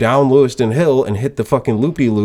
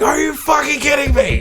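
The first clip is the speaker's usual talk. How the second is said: high-pitched retold yell; explosive disbelief. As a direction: burst out after holding it in. Explodes once they drive away.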